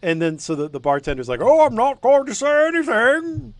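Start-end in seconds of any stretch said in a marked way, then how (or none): 1.4-3.5 high, slow, loud bartender voice; squeaky stubborn bartender. Acted. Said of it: "do all the accents".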